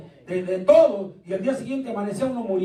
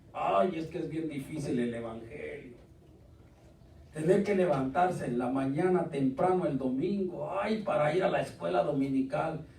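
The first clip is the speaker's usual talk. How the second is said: softer, lower, put-on whining complaint; whiny churchgoer; 5 semitones lower, 8 dB quieter than usual.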